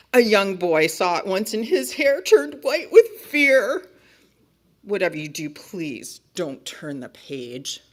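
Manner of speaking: melodramatic warnings, slowing down for each plea; alarmed book character. Acted. Book character keeping the reader from the next page, alarmed. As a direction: reason urgently, then draw out the begging.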